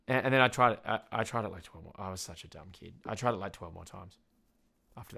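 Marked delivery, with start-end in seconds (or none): none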